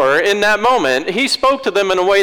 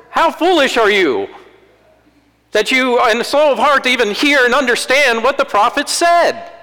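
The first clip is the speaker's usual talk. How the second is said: Jesus calling them out so it lands; stern rebuke; 7 semitones higher than usual. Shown as a demonstration. Jesus rebuking the disciples on the road, stern and raised. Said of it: "I suspect it went more like this".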